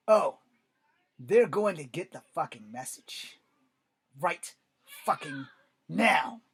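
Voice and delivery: loud, forceful fury, word by word; angry shouting. Acted. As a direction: shout it as a furious father's threat. Enraged father answering his son.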